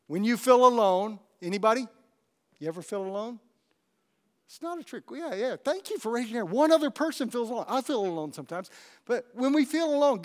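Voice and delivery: usual speaking voice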